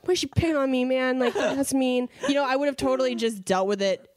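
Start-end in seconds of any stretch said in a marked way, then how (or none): 0.0-2.1 put-on higher-pitched childish grumble; middle schoolish whining. Woman venting about rude cashier, hammed-up middle-school whine. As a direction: complain like a picked-on kid. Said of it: "middle schoolish".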